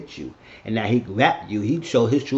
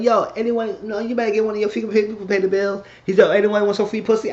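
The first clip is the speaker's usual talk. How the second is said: high-pitched announcement to everyone; mocking callout; 10 semitones higher, 4 dB louder than usual. Eddie refusing to pay the bill, calling out. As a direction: call it out high to the room.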